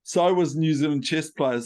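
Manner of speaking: ordinary talk, nothing marked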